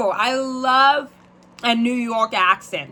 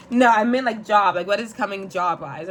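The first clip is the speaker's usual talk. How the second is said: ordinary talk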